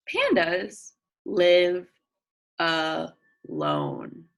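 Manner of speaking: slow, clear read-aloud; reading to children. Acted. Teacher reading a picture book to her class. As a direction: read each word out for young listeners.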